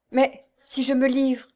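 voiced as a worried outcry; alarmed exclamation. A narrator's reading. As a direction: exclaim it, pleading against surrender. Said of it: "s'écria-t-elle".

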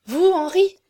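high, loud, rising question; stupeur ardente. A narrator's reading. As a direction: exclaim in astonishment, pitch leaping up. Aurore amazed by Henri's question. (étonnement passionné, voix haute)

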